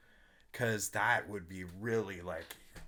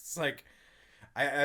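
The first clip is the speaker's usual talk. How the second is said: ordinary talk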